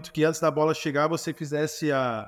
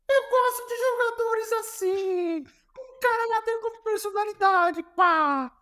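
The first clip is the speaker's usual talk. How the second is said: high, slow mock Portuguese coach giving approval; Jorge Jesus impression; slower than usual. Coach praising his stubborn player, slow high mock Portuguese voice. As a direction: grant respect in a put-on high Portuguese voice. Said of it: "ele grita assim".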